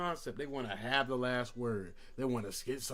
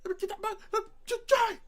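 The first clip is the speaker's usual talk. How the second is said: hammed-up furious Denzel shout; Alonzo yelling; faster than usual. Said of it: "He like".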